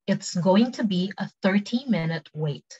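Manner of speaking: slow, clearly separated words; careful slow teaching pronunciation; slow take. Shown as a demonstration. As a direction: model it slowly for repeat-after-me. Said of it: "cepet sama pelan".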